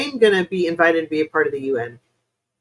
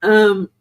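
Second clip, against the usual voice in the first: clearing throat; 3 semitones higher, 8 dB louder than usual. Real, not acted. Coughing fit on live stream.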